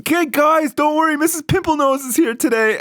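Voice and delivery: put-on high, far-off jeering kid voice; high, sing-song classroom mockery of a teacher; mocking kids. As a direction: taunt her like a cheeky schoolkid.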